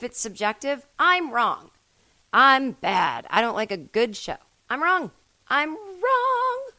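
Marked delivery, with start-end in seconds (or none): 4.7-5.1 high whine. Acted. 5.5-6.7 very high, drawn-out wail; high wailing whine. Acted.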